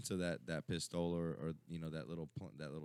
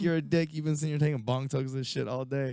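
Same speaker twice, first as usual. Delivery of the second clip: higher, louder mock complaint; mock angry friend; 9 semitones higher, 8 dB louder than usual. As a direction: scold him half laughing. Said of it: "He's like".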